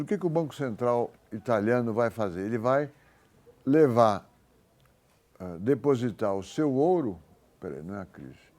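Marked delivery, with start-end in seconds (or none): none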